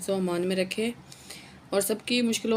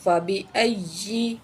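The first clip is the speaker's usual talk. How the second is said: slow, chanted tajweed recitation; melodic Quran recitation; much slower than usual. Shown as a demonstration. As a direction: intone the refrain with long held notes.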